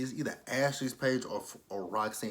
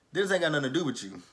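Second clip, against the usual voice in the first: mock scolding; 5 semitones higher, 3 dB louder, faster than usual. Telling Giovanna off over Dennis.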